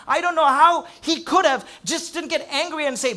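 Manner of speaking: a pastor building to the peak of the sermon; preaching at full force. Acted. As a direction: drive the climax home from the pulpit. Climactic preaching passage.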